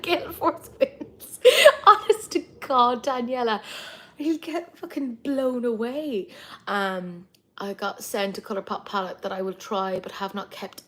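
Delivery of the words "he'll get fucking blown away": ordinary talk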